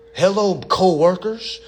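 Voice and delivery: smug pretend colleague; mock greeting. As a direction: greet them like equals, grinning. Greeting CIA as peers.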